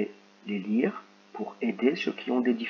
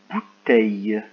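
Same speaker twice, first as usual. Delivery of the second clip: louder, careful word for listening; clear dictation; 7 dB louder, slower than usual. Shown as a demonstration. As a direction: dictate it so the Y ending stands out. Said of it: "C'est parti".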